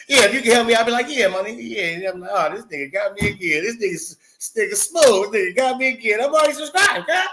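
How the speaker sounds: high, animated retelling; mock excitement. Streamer retelling being tricked into subscribing, excited high voice. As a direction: act out getting fooled, voice climbing high. Acts out being fooled, cracking up.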